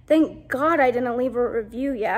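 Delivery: ordinary talk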